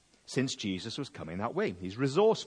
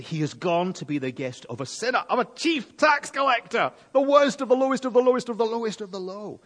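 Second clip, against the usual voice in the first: higher, louder, fast scornful crowd grumble; grumbling crowd; 10 semitones higher, 6 dB louder than usual. Retold or imitated. Crowd sneering at Zacchaeus as Jesus' guest, mock grumble. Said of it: "Began to mutter".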